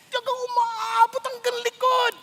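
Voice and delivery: milk it for sympathy; pretending a headache reaches the back; mock complaint. Exaggerating pain for sympathy.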